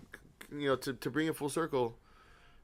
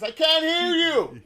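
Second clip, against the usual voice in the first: slow, high, loud, laughing; laughing mock shout; 12 semitones higher, 7 dB louder, slower than usual. Grandpa in the car, laughing loud reply. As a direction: laugh while shouting it back. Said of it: "He says".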